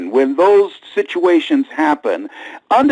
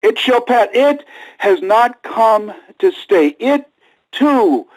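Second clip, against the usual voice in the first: slow soaring preaching; 7 semitones higher, slower than usual. Acted.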